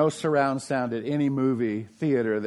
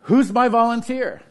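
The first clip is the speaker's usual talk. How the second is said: showman call; 7 semitones higher, 5 dB louder than usual. Retold or imitated. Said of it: "And he goes".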